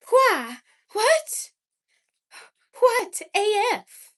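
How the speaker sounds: high, loud mid-Atlantic with WH on everything; mid-Atlantic girl. Shown as a demonstration. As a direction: spoof a mid-Atlantic accent, puffing out each WH. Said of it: "mid-Atlantic, uh, girl who just adds, like, the W H sound".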